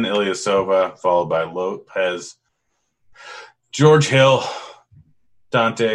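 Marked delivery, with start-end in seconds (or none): 3.7-5.9 talking through a yawn. Real, not acted. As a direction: yawn mid-word.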